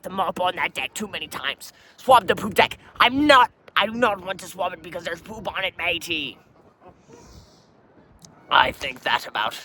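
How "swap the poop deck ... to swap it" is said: higher, louder exasperated refusal; indignant. Real, not acted. Captain refusing to swap the fouled deck, indignant. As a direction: refuse the order in disgust.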